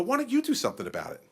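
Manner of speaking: quick pointed question. Retold or imitated. Governor turning the demand back on constituents, quick and pointed. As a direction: toss the question right back.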